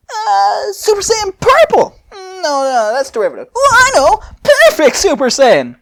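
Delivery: squeaky high musing inventor; high Fu pondering aloud. Acted. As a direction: go way up high and play the giddy scientist. Fu voice held.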